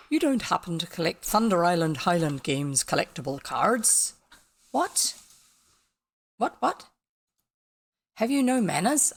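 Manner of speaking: put-on a light gruff grumble from a grumpy old Scot; grumpy Scottish Angus. Reading grumpy Angus's line to the viewers, a light grumpy lilt. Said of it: "sounds Scottish".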